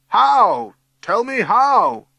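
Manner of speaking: very loud, high, stretched yell; desperate yell. A narrator's reading. Sir demanding to know how to stop it, yelling. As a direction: yell for an answer. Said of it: "yells Sir".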